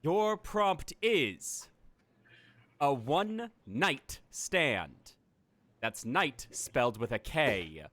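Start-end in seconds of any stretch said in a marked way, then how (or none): 0.0-4.9 drawn-out, deliberate reveal; slow dramatic announcement. Acted. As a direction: announce it slowly like a game host.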